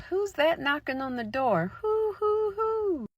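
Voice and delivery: high, loud frightened witch call into owl hoots; scared witches calling. Acted. Frightened witches calling to the knocker, high and loud. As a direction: cry out at the door, fear turning into hooting. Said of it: "Then they called out".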